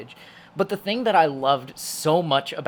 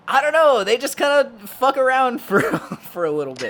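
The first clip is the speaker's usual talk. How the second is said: higher, louder, exasperated shrug of a line; exasperated high mock viewer; 9 semitones higher, 6 dB louder than usual. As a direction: throw up your hands explaining it. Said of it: "you're like".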